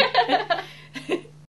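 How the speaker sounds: high peal of laughter; giggling fit. Real, not acted. Laughs after the joke.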